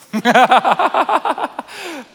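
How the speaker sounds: loud high laugh at the mishap; real loud laughter, high and bright; big burst of laughter. Real, not acted. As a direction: laugh out loud, caught off guard. Arrow hits LED wall, laughter.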